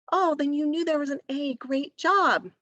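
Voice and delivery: high, quick burst of teacherly delight; encouraging praise. Pretend. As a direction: act thrilled at a child's small success. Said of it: "recognizing their good work".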